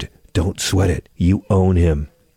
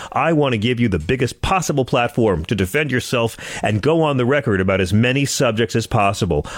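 ordinary talk

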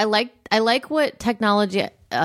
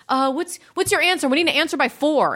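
impatient, higher-pitched demand; pushy demanding voice; 7 semitones higher than usual. Retold or imitated. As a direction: nag for an answer. Voicing pushy email senders.

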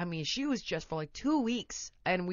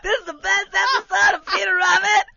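much higher, loud hammed-up cheering; hammed-up excitement; 14 semitones higher, 12 dB louder than usual. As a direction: fake wild delight to tempt the child. Mom fakes excitement at cartoon.

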